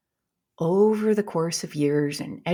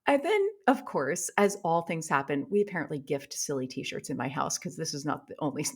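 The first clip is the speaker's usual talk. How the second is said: ordinary talk, nothing marked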